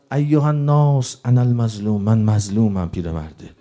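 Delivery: normal talking voice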